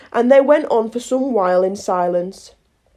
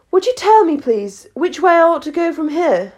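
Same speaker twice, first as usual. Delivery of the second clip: put-on higher, polite young questioner; Alice, politely asking; 7 semitones higher than usual. A narrator's reading. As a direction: ask as a well-mannered Alice. Alice politely addresses the Cat.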